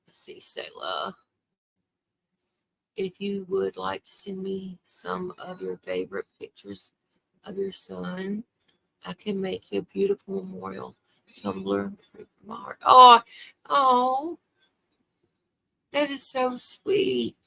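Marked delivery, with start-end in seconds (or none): 0.1-1.1 slow, breathless murmur while scanning comments; whispered aside. Real, not acted. Streamer reading viewer comments, whispering under her breath. 12.8-17.4 a loud, moved "oh" that slows down after; touched surprise. Real, not acted. A grieving mother thanks a viewer for a memorial gift, loud and moved.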